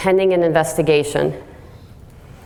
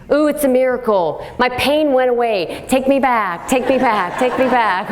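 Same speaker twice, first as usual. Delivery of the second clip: higher, hammed-up sing-song patient pleading; mock miracle patient; 6 semitones higher than usual. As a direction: play the patient begging to go back, mockingly. Mocking patient wheeled up.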